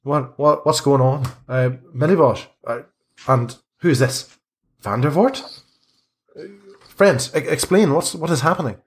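high, startled priest demanding answers; Father Lucian's alarmed voice. Acted. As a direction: raise the pitch, bewildered and pleading. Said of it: "and he says".

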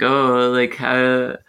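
ordinary talk, nothing marked

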